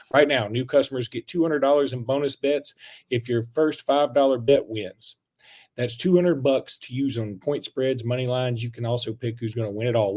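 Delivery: casual sponsor read. Acted. Host reading FanDuel ad, ad-read voice. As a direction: pitch the sportsbook, half joking.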